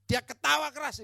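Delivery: high, loud dramatic testimony; full-force preaching. Retold or imitated. Preacher telling church of a deliverance, full-force preaching. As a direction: tell it like a fired-up preacher.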